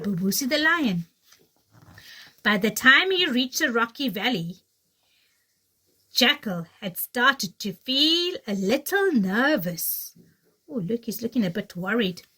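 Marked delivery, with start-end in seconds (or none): 2.4-10.1 put-on lively read-aloud for kids; storybook reading. Teacher reading The Lion and the Clever Little Jackal to children, animated storytelling. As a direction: tell it like a bedtime tale.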